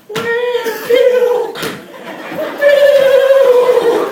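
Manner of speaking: put-on high loud baby wail; hungry baby. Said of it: "the baby's like".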